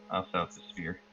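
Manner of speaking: ordinary talk, nothing marked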